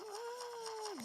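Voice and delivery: high, short mock shout; imitated distant yell. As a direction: copy a faraway cry. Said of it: "bağırma sesleri geliyor". (tiz, kısa taklit bağırış)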